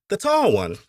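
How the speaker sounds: high-pitched Appalachian overseer; overseer pointing. A narrator's reading. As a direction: point someone out in a high character voice.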